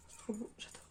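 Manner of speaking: whispered delight. Real, not acted. Vlogger admiring her friend's keychain to herself, whispered. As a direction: breathe it to yourself in admiration.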